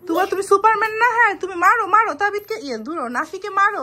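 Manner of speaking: loud, high, excited cheering; playful egging on. Real, not acted. Mother cheering her kids on in a play fight, loud and high.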